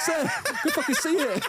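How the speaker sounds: high, giggling squeal; can barely get it out for laughing; cracking up mid-quote; laughing through it. Retold or imitated. Retold quote amid laughter.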